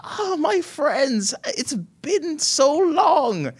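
very high, very slow, tender; old woman. Acted. Glenda greeting old friends, very high and slow. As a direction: savor each word like a delighted old friend. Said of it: "And she says".

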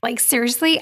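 ordinary talk, nothing marked

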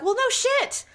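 high, sarcastic no shit; deadpan the obvious; sarcastic retort. Retold or imitated. Sarcastic reply to husband.

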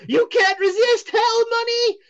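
dramatic seer. Acted. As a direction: tempt them like a showman. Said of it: "and he says".

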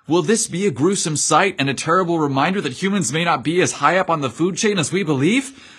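plain reading voice

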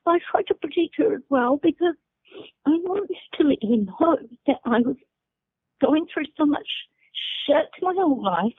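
real tears pushing through the words; choked up while protecting her mum; holding back tears. Real, not acted. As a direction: voice lifted, close to crying. Tearing up recalling hard life.

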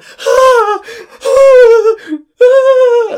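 high, loud fearful wailing cry; terrified wail. Retold or imitated. Retelling the monkey bars to friends, a terrified high wail. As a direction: wail like you're dangling over a drop. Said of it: "and I was going".

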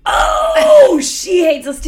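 sudden high, loud laughing outburst; laughing shout. Real, not acted. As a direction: blurt it out with a laugh. Sudden loud outburst about the girl.

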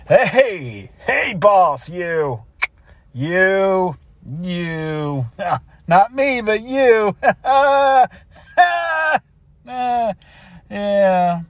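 hearty, joking hello with forced laughs; butter up the boss; fake chummy greeting.

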